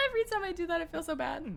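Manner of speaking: high squealing laughter through the words; laughing guiltily. Real, not acted. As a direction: squeal it helplessly, settling as the laugh fades. Guilty laughing while playing the game.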